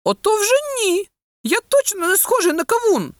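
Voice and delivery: put-on high-pitched boy protesting; disagreeing. A narrator's reading. Oleksa rejecting the comparison with watermelons, disagreeing. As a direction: object firmly in a child's voice. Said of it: "не погодився Олекса".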